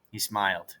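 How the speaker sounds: usual speaking voice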